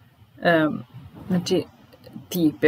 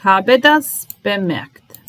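slow and deliberate, for learners to copy; slowed teacher read; slow model sentence; slower than usual. Shown as a demonstration. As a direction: spell it out slowly for the class. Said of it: "teacher models the sentence slowly".

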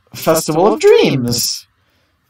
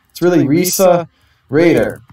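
ordinary talk, nothing marked

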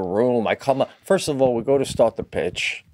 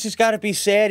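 normal talking voice

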